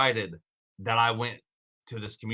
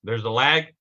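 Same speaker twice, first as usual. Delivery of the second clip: normal talking voice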